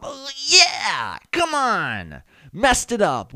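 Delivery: high, loud, drawn-out hollering; hammed-up celebration. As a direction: whoop over a botched fist bump. Acts out botched fist bump celebration.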